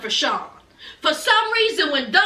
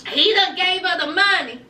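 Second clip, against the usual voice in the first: usual speaking voice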